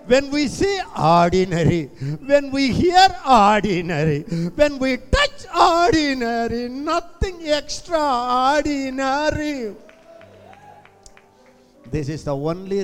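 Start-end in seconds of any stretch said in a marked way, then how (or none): 0.1-9.7 rising higher and louder; building emphasis. Real, not acted. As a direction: build up each line.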